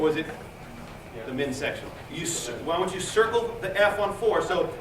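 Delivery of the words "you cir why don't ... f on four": usual speaking voice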